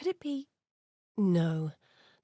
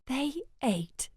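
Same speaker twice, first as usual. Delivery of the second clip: ordinary talk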